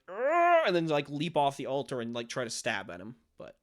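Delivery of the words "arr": high, loud charging yell; battle cry. Retold or imitated. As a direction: yell as you leap to attack. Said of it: "You could be like".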